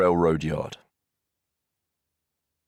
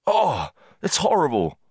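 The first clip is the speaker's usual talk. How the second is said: high, horrified cry; horrified scream; 10 semitones higher than usual. A narrator's reading. Engineer bending over the body, crying out horrified. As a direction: cry out in horror. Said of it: "he screamed".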